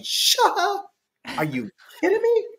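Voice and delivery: high, laughing mock outrage; mock disbelief. Father teasing his grown son about going along, high mock disbelief. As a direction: tease him with a shocked squeal. Dad mocking son's request.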